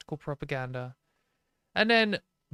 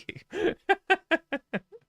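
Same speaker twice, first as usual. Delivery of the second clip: loud high laugh; 4 dB louder than usual. Real, not acted. Laughs at posh comment.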